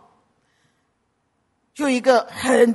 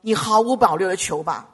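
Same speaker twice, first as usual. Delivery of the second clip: normal talking voice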